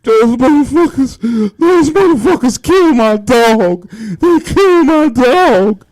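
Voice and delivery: high, loud wailing grief, slowing at the end; grieving owner cries over his dead dog; imitated ugly cry. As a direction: sob it out, voice cracking with rage. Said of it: "that ugly cry".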